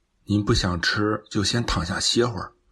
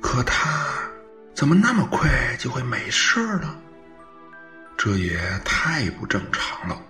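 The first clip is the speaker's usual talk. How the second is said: baffled self-talk, pitch jumping up and dropping; talking to himself; 2 semitones higher than usual. Acted. Son alone at night recalling strange events, uneasy self-talk. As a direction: puzzle it out aloud with growing unease. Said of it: "自言自语".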